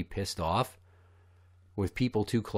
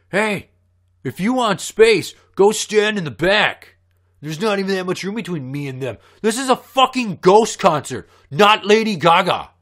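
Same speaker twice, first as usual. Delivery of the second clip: high, loud, whiny nerd comeback; loud, high imitation of a nerdy teen at a concert; 8 semitones higher, 9 dB louder than usual. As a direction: mimic the snotty kid sneering back. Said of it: "that's how he sounded".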